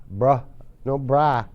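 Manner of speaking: bra, no bra, slow, vowel between A and O; slow bra then bra, second vowel darker; contrasting vowels slowly. Shown as a demonstration. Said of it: "un tono un poquito más bajo".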